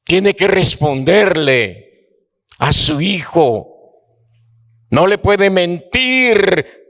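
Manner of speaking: high, slow, near-shouted sermon climax; impassioned preaching. Acted. Preacher telling the congregation of Abraham's agony, in an impassioned near-shout. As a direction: cry out the father's anguish slowly.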